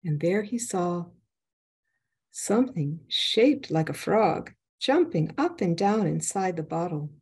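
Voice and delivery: plain reading voice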